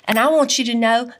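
usual speaking voice

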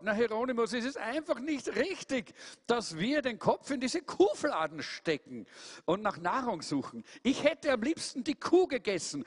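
hohe, klagende Truthahnstimme; Truthahn Tom. Acted. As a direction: quengelnd und hoch. Tom klagt mit hoher Stimme. (Tom klagt dem Bruder, hohe Stimme)

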